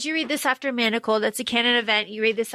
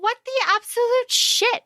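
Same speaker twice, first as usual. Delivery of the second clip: outraged; 11 semitones higher than usual. Retold or imitated. Retold Draco outraged.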